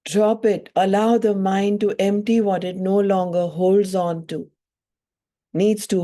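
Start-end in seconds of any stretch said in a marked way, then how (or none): none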